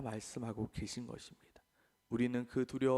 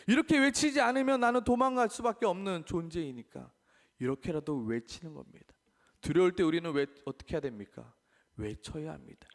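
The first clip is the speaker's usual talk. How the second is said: usual speaking voice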